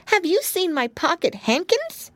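put-on high, small child's voice; a little girl asking about her lost handkerchiefs. A narrator's reading. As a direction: ask like a polite little girl. Lucy voiced in story.

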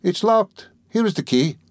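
high, singsong teasing; lightly voiced Porfiry, playful. Examining lawyer showing the key, high and playful. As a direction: show off the secret lightly.

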